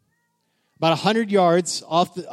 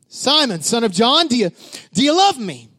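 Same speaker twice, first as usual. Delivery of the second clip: pressing louder; 6 semitones higher, 5 dB louder than usual. Acted. Jesus repeating the question to Peter, louder and higher. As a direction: ask again, higher and louder.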